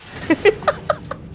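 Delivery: airy giggle bursting out; breathy high laugh. Real, not acted. Laughs retelling the story. (pabulong na hagikgik)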